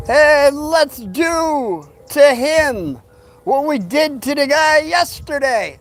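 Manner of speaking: high, very slow taunting bully voice; mocking Bradley. Bradley taunting the gang about the new kid, high and drawn out. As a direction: drag it out like a smug gang leader egging on the crowd. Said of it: "And then Bradley says".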